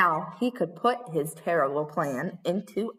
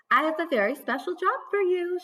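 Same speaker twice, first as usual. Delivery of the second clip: a touch higher, sweet mother voice; mother's voice; 8 semitones higher than usual. Acted. Mother giving Little Red a job, gently higher. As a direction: lightly voice the kind mother.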